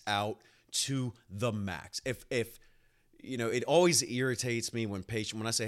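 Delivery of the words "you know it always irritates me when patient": ordinary talk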